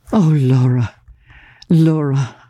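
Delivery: gently sorrowful, not reproving; mild, sad appeal, no anger; a touch of sorrow. A narrator's reading. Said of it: "with nothing but sorrow in my voice".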